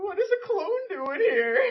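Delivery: high, loud and slow, with a laughing whine; drawn out in mock disbelief; confused shock. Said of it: "I was like".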